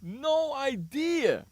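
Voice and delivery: slow, drawn-out emphasis; slow disbelieving drawl; slow emphatic. Real, not acted. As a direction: stretch every word for effect.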